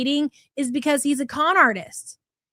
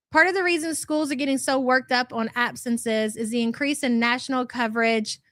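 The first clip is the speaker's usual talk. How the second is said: ordinary talk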